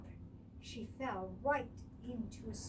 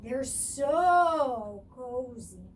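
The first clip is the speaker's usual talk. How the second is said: slow, louder dreamy delight; cozy; 9 dB louder, much slower than usual. A narrator's reading. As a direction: savor how cozy it is. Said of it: "said Coco".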